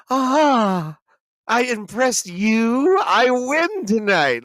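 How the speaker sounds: smug gloating. Retold or imitated. Smug reply to bartender.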